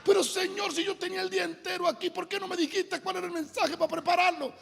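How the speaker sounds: high-pitched, hurried complaint; protesting to God. Acted. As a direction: plead in exasperation, pitch way up. Acts out pleading protest to God. (protesta suplicante en tono agudo)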